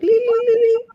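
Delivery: high-pitched mock kid babble; high, silly child imitation; imitating son. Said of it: "He'd be like".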